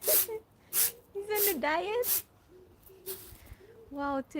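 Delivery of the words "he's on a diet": words squeezed out through laughter; slow, breaking up with laughs as you say it; laughing. Real, not acted. Teasing a friend about Zach, laughing through it.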